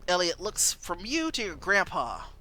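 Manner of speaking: normal talking voice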